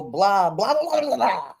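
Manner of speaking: high, babbled "blah blah" of a cartoon devil; spinning devil gibberish. Reading Bob's joke about spinning devils, mock creature babble. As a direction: imitate a whirling Tassie devil. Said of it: "they spin and go".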